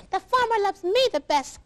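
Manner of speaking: high, clucking hen voice; chicken voice. Acted. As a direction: boast like a proud hen. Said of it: "clucked the chicken".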